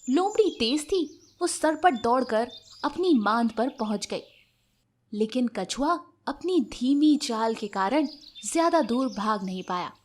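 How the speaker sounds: plain reading voice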